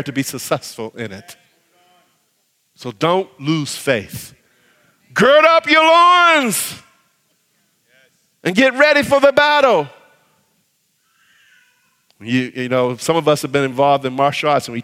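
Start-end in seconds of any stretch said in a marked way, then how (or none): 5.1-9.9 rallying cry. Real, not acted. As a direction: rouse the congregation like a war cry. Preacher rallying to battle.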